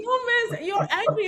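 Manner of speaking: a pupil protesting to her teacher; quoting a girl. Retold or imitated. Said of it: "I'm like".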